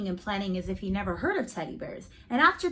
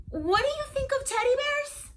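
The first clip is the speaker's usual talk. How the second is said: anxious question; 4 dB louder than usual. Acted. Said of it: "I said again".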